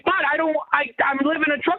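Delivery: getting worked up, insisting; a man losing his patience at the border; heated. Acted. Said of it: "Dial it back a little bit".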